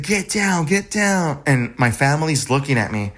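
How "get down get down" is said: urgent retold warning. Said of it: "and I said".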